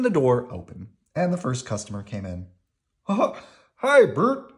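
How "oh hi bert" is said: high, loud, friendly puppet voice; bouncy high hello; Ernie. A narrator's reading. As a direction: greet a pal loudly, pitched up. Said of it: "Ernie said".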